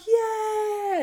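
very high, long yell; imitated fan cheer. Fan answering the Condors cheer, high drawn-out yell. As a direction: answer with a high, held-out whoop. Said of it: "And he's like".

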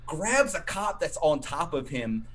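ordinary talk, nothing marked

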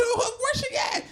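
high, slow; mock suspicious girlfriend. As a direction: demand where the other girl is.

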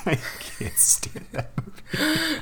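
breathy laugh through the words; laughing whisper. Real, not acted. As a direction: giggle it out, barely voiced. Laughing rant about boring film.

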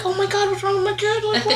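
high-pitched retold panic; alarmed mother. Mom retelling her fright about her kid, panicked. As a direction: alarm rising into a high squeal. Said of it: "And I'm like".

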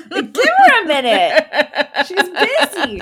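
high, loud, laughing through it; laughing aside. Real, not acted. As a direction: tease the groom while laughing. Mock aside about proposal.

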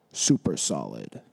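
deep silly echo of the phrase; deep goofy voice. Pretend. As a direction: drop way low and repeat it.

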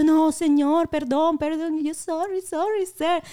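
nervous apology. Retold or imitated. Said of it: "me pongo nerviosa".

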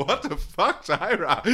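mock shock. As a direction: squeal of outrage through laughter. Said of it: "So I'm like".

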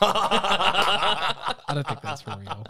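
amused slow laughing doubt. Real, not acted. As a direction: tease while laughing. Teasing, laughing about John Oliver.

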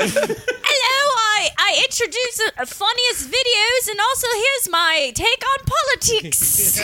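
high, bright presenter parody, slowing at the end; mock TV presenter. Comic mocking a TV presenter, hammed-up host voice. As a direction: ham it up like a chirpy British TV host.

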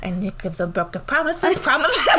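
mock-lisped "promises", s turning to th, breaking into laughter; singer's lisp. Mimicking the TikTokers lip-syncing the song, "promises" lisped with th. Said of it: "The singer has a lisp".

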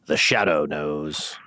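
half-hearted take on The Shadow, dropping low and slow at the end; lightly voiced ominous radio narrator; Shadow imitation. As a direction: try The Shadow's sinister line, not quite pulling it off. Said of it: "That was not good".

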